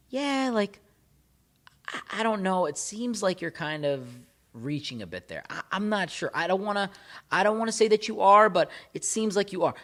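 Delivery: high, hesitant fake concern brushing off the complaint; dismissive doubting tone. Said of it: "It's that kind of tonality".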